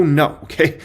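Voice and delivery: normal talking voice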